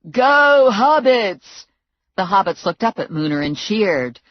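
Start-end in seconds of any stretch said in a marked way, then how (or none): 0.1-1.7 loud, higher cheer; cheering yell. A narrator's reading. Muner cheering the hobbits from the tower, loud. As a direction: cheer them on from above. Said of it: "Muner yelled at them from the tower".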